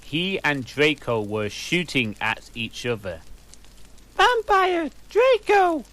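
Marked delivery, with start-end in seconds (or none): 4.1-5.8 much higher, louder startled cry; shrill shocked question. A narrator's reading. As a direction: shriek in shock at the fight. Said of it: "I asked".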